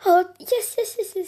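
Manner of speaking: high, loud yes yes yes; jackpot winner. Pretend. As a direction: cheer like you won big. Thrilled by an expensive gift.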